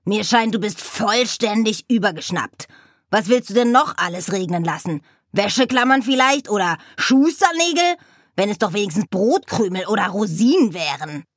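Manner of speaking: scolding raven. Acted. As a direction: tell her off as a cross raven.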